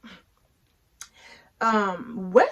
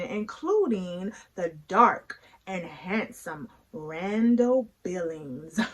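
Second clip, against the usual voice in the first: ordinary talk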